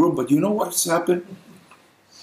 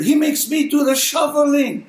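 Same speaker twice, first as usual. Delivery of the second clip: mock complaint; 7 semitones higher than usual. Real, not acted. Mock complaint about son.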